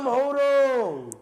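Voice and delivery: usual speaking voice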